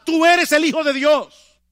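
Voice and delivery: loud, high, slow shout; demons shouting. Retold or imitated. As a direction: shout it as the spirits did. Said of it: "que le gritaban".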